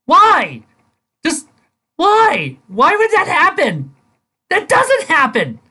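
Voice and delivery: high, loud exasperated protest; outraged disbelief. Real, not acted. Reviewer ranting at the fanfic's logic, exasperated and loud. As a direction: argue with the story, fed up.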